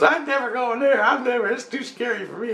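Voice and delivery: high-pitched mock fearful refusal; imitated scared daughter. Daughter refuses the scary place, imitated high and protesting. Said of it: "my daughter goes".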